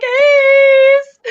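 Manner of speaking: wife bawling to her husband about her dog; sobbed name. Retold or imitated. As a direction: cry out the dog's name. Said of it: "I'm like".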